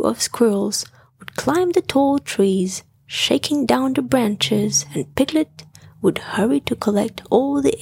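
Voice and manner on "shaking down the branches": usual speaking voice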